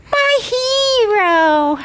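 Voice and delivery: very high, slow adoring sigh; swooning damsel. Acted. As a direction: gush it like a rescued heroine. Victim swoons at rescuer.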